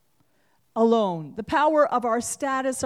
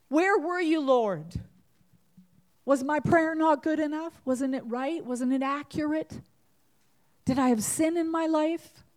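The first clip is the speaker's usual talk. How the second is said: angry prayer; 6 semitones higher than usual. Retold or imitated. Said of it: "cried out to God".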